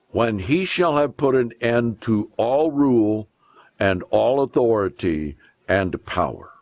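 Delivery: ordinary talk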